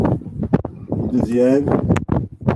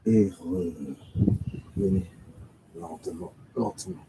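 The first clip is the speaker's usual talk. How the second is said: hushed, low, nearly whispered; barely audible low murmur; 9 semitones lower, 11 dB quieter than usual. Real, not acted. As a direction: ease them down very softly. Guiding a slow return movement.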